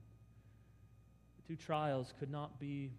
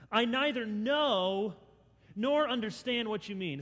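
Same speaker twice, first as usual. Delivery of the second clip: double denial; 5 semitones higher, 7 dB louder than usual. Acted. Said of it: "he gives a double denial".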